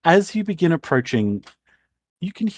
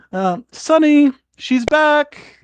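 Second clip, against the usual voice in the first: high, drawn-out motherly call; acted mum's holler, pitched up; 13 semitones higher, 4 dB louder, slower than usual. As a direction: call the news through to your husband. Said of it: "and goes".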